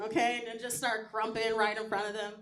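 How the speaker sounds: normal talking voice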